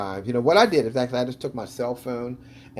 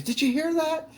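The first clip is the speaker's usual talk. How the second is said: mock startled question. Quoting man again, very high.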